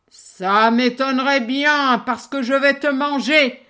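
loud menacing troll. Acted. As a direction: threaten like a hungry troll. Troll threatens the little goat.

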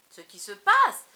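cri furieux, beaucoup plus fort et plus aigu; hurlement. Acted. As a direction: hurler de rage contre sa femme. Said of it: "hurla-t-il". (crie à pleine voix)

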